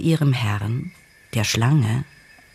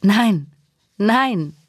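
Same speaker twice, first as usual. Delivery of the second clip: high, raised-voice protest; crying out; 7 semitones higher, 4 dB louder than usual. A narrator's reading. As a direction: cry it out in narrated alarm, pitched well up. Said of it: "schrie Nandi". (aufschreiend, mit hoher Stimme)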